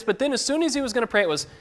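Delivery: ordinary talk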